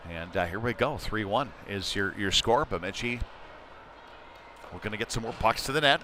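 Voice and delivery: ordinary delivery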